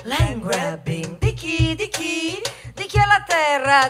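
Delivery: ordinary delivery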